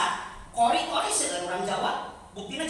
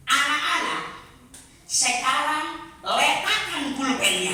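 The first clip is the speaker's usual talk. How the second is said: put-on higher, louder, slow stern teacher call to kids; a Batak teacher speaking to kindergarteners, slow and raised; 5 semitones higher, 6 dB louder, slower than usual. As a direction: play the Batak kindergarten teacher ordering the class. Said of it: "Cek guru teh".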